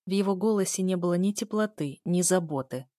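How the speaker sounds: plain reading voice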